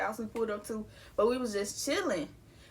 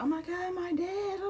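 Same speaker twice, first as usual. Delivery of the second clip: high, softened mock distress; mock breaking down; 8 semitones higher, 5 dB quieter than usual. Imitating how most people take a death, mock breaking down. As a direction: imitate someone falling apart, pitched up and quieter. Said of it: "like, breaking down".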